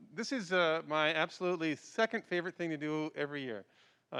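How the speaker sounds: usual speaking voice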